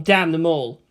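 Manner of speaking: ordinary delivery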